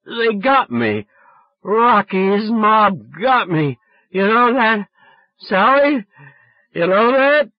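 put-on loud, high, slow drunken wail; drunk play-acting. A narrator's reading. Drunk boy pretending mob shot him, hammed-up wail. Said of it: "holding on to my guts".

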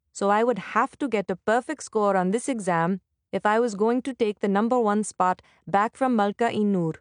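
plain reading voice

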